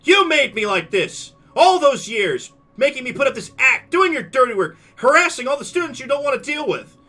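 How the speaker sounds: high, loud tearful tirade; yelling. Acted. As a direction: scream it across the whole room. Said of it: "She starts yelling".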